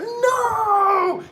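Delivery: drawn out, very high scream; high anguished mock scream. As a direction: wail the denial. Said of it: "He's screaming".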